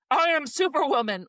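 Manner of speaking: mock triumphant. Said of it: "The next day I'm like".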